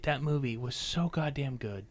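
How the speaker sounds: hushed aside; whisper. Real, not acted. Praising a favourite film in a whisper. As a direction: breathe it as a secret.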